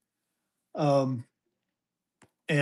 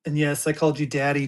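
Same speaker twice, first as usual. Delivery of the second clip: ordinary delivery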